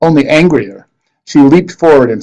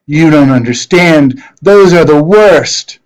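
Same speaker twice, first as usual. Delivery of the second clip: panicked sorceress; 5 semitones higher, 5 dB louder, slower than usual. Acted. Sorceress panicking at freed pirates.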